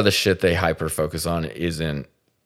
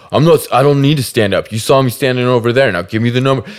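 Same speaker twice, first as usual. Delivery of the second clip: loud, higher mock macho snap; angry retort; 6 semitones higher, 6 dB louder, faster than usual. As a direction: bark it like a guy asserting dominance. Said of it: "dom her in this really dumb".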